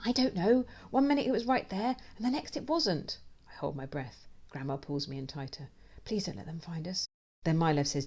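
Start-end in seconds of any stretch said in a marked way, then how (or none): 0.0-3.2 high-pitched, bewildered voice; flustered woman. A narrator's reading. Flustered woman explains fright. 6.0-7.1 soft, anxious wish; hushed plea. A narrator's reading. Hiding mouse silently pleads.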